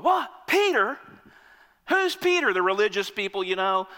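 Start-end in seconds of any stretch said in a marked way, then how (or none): 0.0-2.5 high, loud disbelief; mock outrage at the choice; high pitched astonished onlooker; incredulous crowd. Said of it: "people going".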